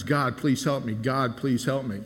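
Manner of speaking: ordinary delivery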